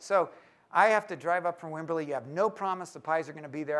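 ordinary talk, nothing marked

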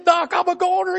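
high, tearful whine about pain; whimpering hypochondriac. Retold or imitated. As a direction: whine like every touch is agony. Arzy wailing.

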